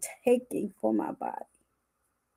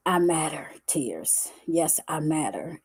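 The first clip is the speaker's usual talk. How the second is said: tearful whisper; 5 semitones lower, 6 dB quieter than usual. Real, not acted. Emotional affirmation of self-worth.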